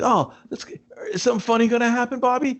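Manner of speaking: high, quick hopeful question; mock anticipation. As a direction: voice eager hope way up high and fast. Voicing own hope for a joke.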